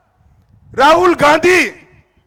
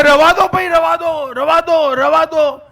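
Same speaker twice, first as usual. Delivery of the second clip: mocking opponents; 6 semitones higher, 3 dB louder than usual. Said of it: "મેં કહ્યું".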